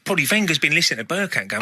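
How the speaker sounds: ordinary talk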